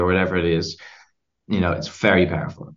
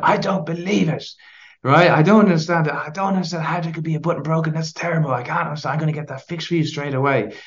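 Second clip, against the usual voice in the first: high, over-the-top indignation; mock outrage; 8 semitones higher than usual. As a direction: act appalled on her behalf. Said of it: "so he gets angry".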